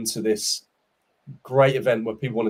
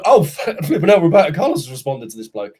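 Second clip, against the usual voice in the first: high and loud, laughing in disbelief; laughing shock at the news; amazed laughing exclaim; 5 semitones higher, 7 dB louder, faster than usual. Retold or imitated. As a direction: gasp it out with a laugh. Retold amazed reaction.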